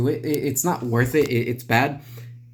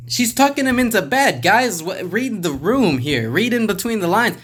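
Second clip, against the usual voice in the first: exasperated disbelief; 6 semitones higher, 3 dB louder than usual. Real, not acted. Exasperated at the comic.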